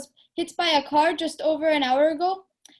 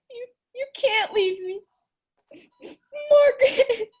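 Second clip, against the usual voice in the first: sobbing; 5 semitones higher than usual. Acted. Mother weeps at comatose daughter.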